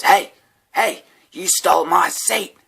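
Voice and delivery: put-on high, loud drunken growl of a stranger; homeless guy's voice. Homeless man accusing me of stealing his seat, mock angry. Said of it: "And he goes".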